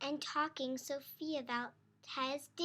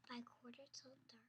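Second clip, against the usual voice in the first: soft unhurried whisper as she drifts off; sleepy whisper; 9 dB quieter than usual. A narrator's reading. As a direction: lull them toward sleep. Bedtime lull in story.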